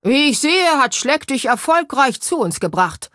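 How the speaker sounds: proud ghost admiral booming a welcome. A narrator's reading. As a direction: boom so the moustache shakes. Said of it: "dröhnte der Mann so laut".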